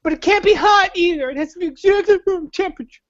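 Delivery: put-on high, fast whining kid begging his mom; mock a spoiled child crying for his blankie; whiny child. Friend mocks him as a whiny child calling for mom, high whine.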